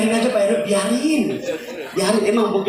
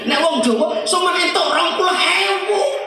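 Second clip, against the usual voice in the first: high, slow indignant scolding; wife scolding husband over meagre earnings; 10 semitones higher than usual. Retold or imitated. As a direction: tell him off for so little money.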